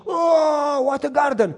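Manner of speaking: loud, high, drawn-out wonder; amazed exclamation. Retold or imitated. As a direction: shout out in mock awe as the wife. Wife sees the garden, amazed.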